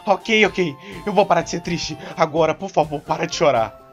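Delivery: shouted, flustered giving-in; exasperated loud plea. Acted. Trainer begs the crier to stop, loudly exasperated. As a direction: yell it to make the crying stop. Begging someone to stop crying.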